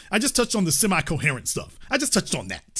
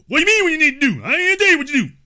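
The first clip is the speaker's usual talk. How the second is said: high-pitched indignant comeback, imitated; indignant retort; 9 semitones higher, 3 dB louder, much faster than usual. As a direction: mimic someone balking at the question, voice pitched up. Retold indignant reply on phone.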